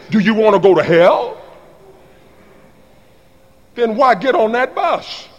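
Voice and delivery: ordinary delivery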